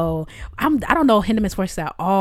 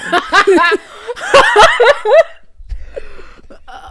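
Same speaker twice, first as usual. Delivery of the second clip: loud laugh; 9 dB louder than usual. Real, not acted. Big laugh after friend's answer.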